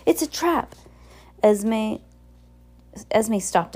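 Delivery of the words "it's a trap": high-pitched warning cry. A narrator's reading. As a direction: call out the danger in a high voice. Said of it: "she cried".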